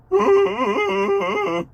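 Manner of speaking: high-pitched giggle; high laugh. Real, not acted. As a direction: burst out in a squeaky laugh. Laughs after mocking voice.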